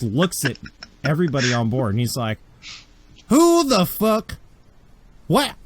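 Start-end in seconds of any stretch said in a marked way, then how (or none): 3.3-5.5 put-on slow, loud and high accented shout; accented captain yelling across the ship. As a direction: roar it in a thick frontier accent. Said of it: "who is this that's yelling".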